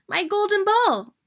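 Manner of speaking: high, loud, thrilled; overjoyed to have it back; delighted cry. A narrator's reading. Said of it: "said the princess".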